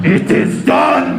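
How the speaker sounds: loud, slow, booming evil declaration; dramatic villain proclamation. Acted. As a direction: proclaim it like a dark sorcerer. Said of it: "this is the noise I make when I come".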